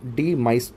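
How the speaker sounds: "डी माइस" slowly, as two separate words; split pronunciation. Shown as a demonstration. Teacher showing a memory trick, "डी माइस" split slowly in two. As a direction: break "डी माइस" into dee and mice. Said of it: "डी और माइस अलग-अलग करके".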